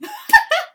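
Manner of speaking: sudden high laugh bursting out; loud high cackle of recognition; burst of laughter. Real, not acted. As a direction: crack up at yourself. Laughing at own crying story.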